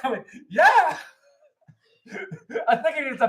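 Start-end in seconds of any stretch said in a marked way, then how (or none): 0.5-1.0 high, loud burst of enthusiasm; eager yes. Retold or imitated. Moviegoer answering the ticket guy, eagerly excited. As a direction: shout it back with total confidence.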